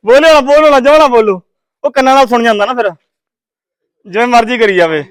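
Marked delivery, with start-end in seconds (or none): none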